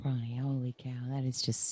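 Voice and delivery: breathless whisper of amazement; hushed holy cow, slowed right down; awed whisper. Real, not acted. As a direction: whisper it, floored by what you heard. Awed by guest's words.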